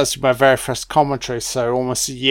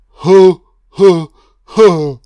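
mock Santa ho ho ho; 7 dB louder, much slower than usual.

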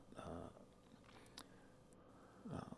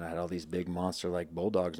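ordinary talk, nothing marked

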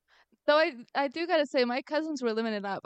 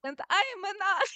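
high, hammed-up Italian accent; mock Italian accent; 8 semitones higher than usual. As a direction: introduce yourself in a put-on Italian accent. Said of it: "why are you doing an Italian accent".

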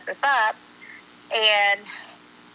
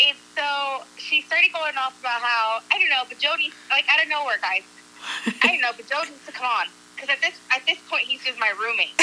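ordinary delivery

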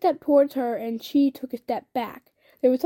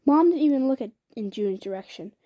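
ordinary delivery

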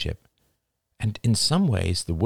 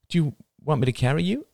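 higher, quick motherly offer; coaxing mother; 9 semitones higher, faster than usual. A narrator's reading. Mother coaxing her son, quick and high. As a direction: offer it fast and kind.

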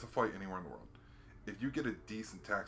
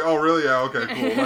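mocking locals; 5 semitones higher, 16 dB louder than usual. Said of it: "they're like".

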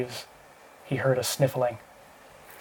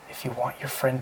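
whisper; 6 dB quieter than usual. A narrator's reading. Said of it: "Marshall whispered".

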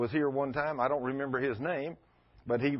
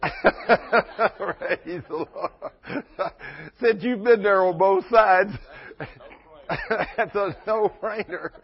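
laughing; 5 semitones higher, 6 dB louder, much slower than usual. Real, not acted. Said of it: "laughs while speaking".

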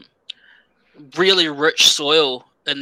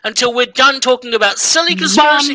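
put-on high, raised voice telling off a toddler; mock scolding; 6 semitones higher, 3 dB louder than usual. Host to a two-year-old interrupting the show, mock scolding.

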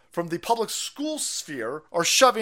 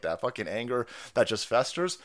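usual speaking voice

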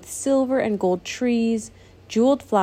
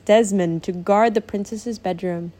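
ordinary delivery, nothing marked

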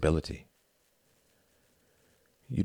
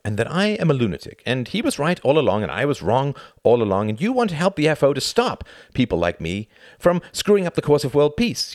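building louder and higher, mock-encouraging; exasperated rant; 8 semitones higher, 4 dB louder than usual. Tom advising his friend on joining the appeasers, exasperated. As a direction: rattle off the advice with rising sarcasm.

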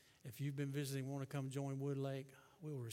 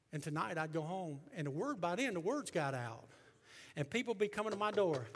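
raised preaching voice climbing higher and louder; preaching, building; 4 semitones higher, 6 dB louder than usual. Real, not acted. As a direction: build to a loud pulpit cry. Preaching builds to full force.

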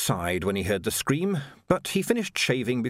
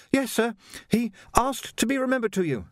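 put-on much higher, polite young Jocasta, a touch breathless; young woman voiced; 12 semitones higher than usual. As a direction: play a well-mannered girl treading water. Jocasta about her father.

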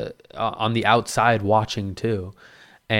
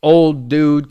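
ordinary talk, nothing marked

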